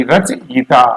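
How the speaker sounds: ordinary talk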